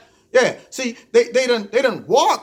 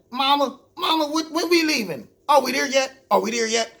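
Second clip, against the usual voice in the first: lightly imitating a whining child, pitched up; whining kids; 5 semitones higher than usual. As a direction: mimic impatient kids pestering mama. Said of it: "They got kids just whining".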